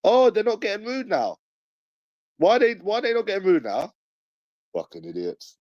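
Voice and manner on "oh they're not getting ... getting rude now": high, sing-song mock voice taunting police. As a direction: jeer at them in a high teasing voice. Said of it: "I was like".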